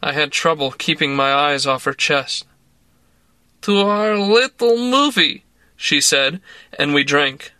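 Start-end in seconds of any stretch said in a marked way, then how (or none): 3.6-5.4 put-on slow, higher Russian-accented woman coaxing; tipsy Russian woman urging a drink. A narrator's reading. Woman pressing vodka on narrator, in a Russian accent. Toast in Larissa voice.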